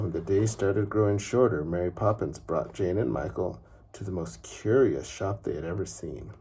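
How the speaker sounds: usual speaking voice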